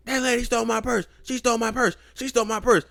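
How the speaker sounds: high, loud repeated outcry; retold alarmed shouting. Hospital worker reporting a stolen purse, shouting in alarm. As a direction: imitate a worker raising the alarm.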